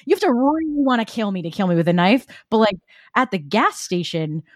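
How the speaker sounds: usual speaking voice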